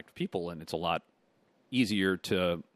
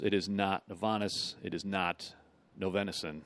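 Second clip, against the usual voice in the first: usual speaking voice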